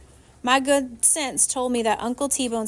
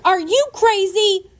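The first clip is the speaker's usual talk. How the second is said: loud, high, slow outraged holler; 9 semitones higher, 7 dB louder, slower than usual. A narrator's reading. Girl hollering at the woman on the roof, loud and high. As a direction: yell it up at the roof. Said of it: "and hollered".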